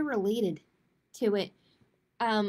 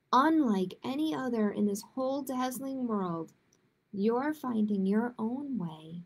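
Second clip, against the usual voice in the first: lilting picture-book read-aloud, a little higher; reading to children; 3 semitones higher than usual. Acted. Librarian reading a picture book to young listeners, lilting storytime voice.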